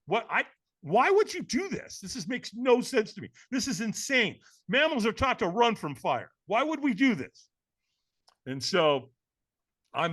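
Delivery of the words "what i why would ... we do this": high, emphatic disbelief; worked-up, baffled inner voice; incredulous protest. Retold or imitated. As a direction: protest that it's madness. His brain protests at firewalking.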